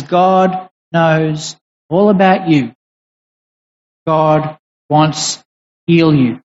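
slow, low and reassuring; gentle reassurance. Retold or imitated. Jesus calming the deaf man's fear, in a slow low reassuring voice.

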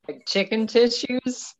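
giggling through the words; laughing. Real, not acted. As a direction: laugh while saying it slowly. Pitching silly fake sponsor.

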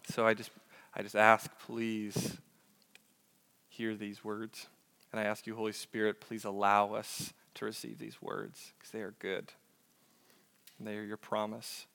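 soft, hushed pastoral prayer. Acted. Pastor praying before reading Isaiah, soft hushed prayer. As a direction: pray quietly over the family before the reading. Prays over the congregation.

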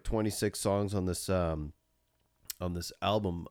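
usual speaking voice

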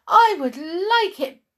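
very high, loud dog voice; eager yelp. A narrator's reading. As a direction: yelp with delight like a puppy. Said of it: "yelped the dog".

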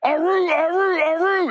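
high, loud battle whoop; crowd war cry. Retold or imitated. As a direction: roar like a pumped-up crowd.

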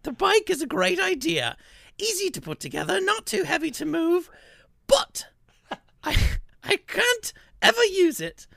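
high, piercing reviewer voice; high-pitched put-on voice of an upset customer; Ginny's voice. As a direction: read her review in a high, whiny voice. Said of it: "This is what Ginny sounds like to me".

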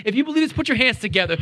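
ordinary delivery

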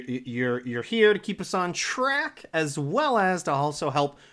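ordinary talk, nothing marked

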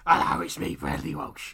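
loud put-on Cockney; bad Cockney quiz-host greeting; Bradley Walsh. Host introducing himself to viewers, hammy Cockney. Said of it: "the only Cockney voice I can do".

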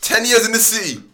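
high, loud disbelief; astonished exclamation. Real, not acted. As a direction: exclaim it, amazed at how long. Reacts to hearing ten years.

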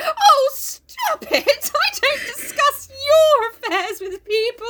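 very high, slow, hammed-up indignant whine; embarrassed, annoyed dog. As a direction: wail like a mortified prim lady. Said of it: "frightfully embarrassed and very annoyed".

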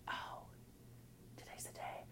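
barely voiced whisper to yourself; soft whispered aside; hushed inner thought. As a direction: whisper it very softly, as a private realization. Retold hopeful waking thought.

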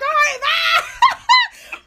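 high, drawn-out goodbye breaking into laughter; laughing farewell. Retold or imitated. Woman seeing Frank off, high laughing send-off. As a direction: sing out the goodbye very slowly and high.

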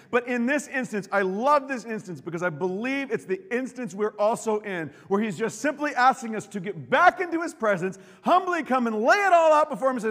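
loud, high impassioned preaching; preaching at full force. Real, not acted. Preacher pleading with the congregation, impassioned. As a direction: drive the promise home to the crowd.

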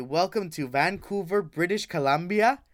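ordinary talk

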